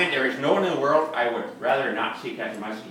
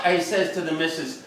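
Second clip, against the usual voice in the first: normal talking voice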